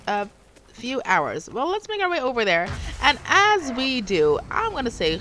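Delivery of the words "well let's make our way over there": ordinary talk, nothing marked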